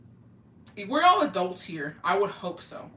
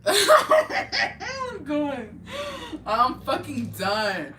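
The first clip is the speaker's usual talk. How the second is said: laughing through words; 9 semitones higher, 6 dB louder, much slower than usual. Retold or imitated. Retold bystanders laughing.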